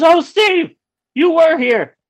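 high, loud imitation of her cry; surprised recognition. Date turning to find him in the theater, surprised exclamation. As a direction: call back high and loud, astonished.

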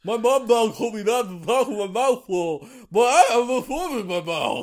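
high goofy dog voice; squeaky, silly sword dog voice; Zacian impression. Still voicing the sword dog.

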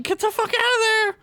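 high-pitched mock yell of alarm; acted yell. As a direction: yell a warning in a squawky high voice. Acted warning to people near bears.

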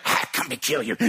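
lightly voiced menacing demon. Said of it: "a voice of a different man".

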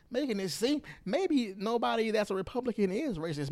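ordinary talk, nothing marked

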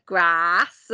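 "Grass" with a drawn-out open ah; Manx long 'ah'. Shown as a demonstration. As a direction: stretch the vowel in "Grass" to a long ah. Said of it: "you know, the ah".

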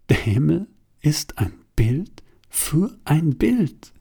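slow unvoiced whisper of a thought. A narrator's reading. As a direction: let the line drift out as a breathy secret. (langsam geflüstert)

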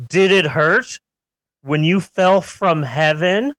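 normal talking voice

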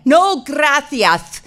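slow no gracias, gracias as grathias; Castilian accent. Retold or imitated. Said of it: "they have that Castilian accent".

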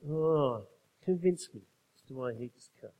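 low, quiet mob-boss voice; Godfather impression. God as we imagine him demanding reasons, Godfather voice. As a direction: play God as a skeptical Godfather, hushed and low. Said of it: "You're like the Godfather".

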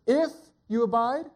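"If" high and punched, "abide" left rising; questioning emphasis on "If"; stress on If. Shown as a demonstration. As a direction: hit "If" high, then pause briefly. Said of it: "when you emphasize a word".

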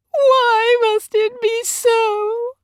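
very high, slow, loud wail; keen it in drawn-out grief; anguished wife's lament. A narrator's reading. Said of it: "Mamma keened".

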